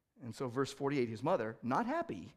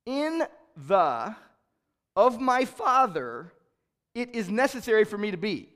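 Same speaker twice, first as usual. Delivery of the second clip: word for word; 7 semitones higher, 11 dB louder than usual. Shown as a demonstration. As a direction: read it out one word at a time, loud. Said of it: "it reads like this".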